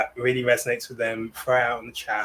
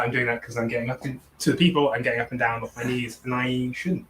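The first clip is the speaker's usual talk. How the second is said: ordinary delivery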